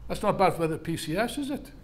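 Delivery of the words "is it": ordinary delivery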